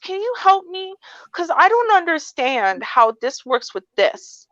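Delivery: high pleading helpless voice; sweet confused. Shown as a demonstration. Asking customer service for help, high pleading voice. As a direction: beg for help, sweet and lost. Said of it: "I'm gonna say it like that, and, like, I'm lost and confused".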